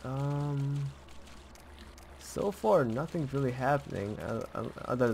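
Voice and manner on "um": ordinary talk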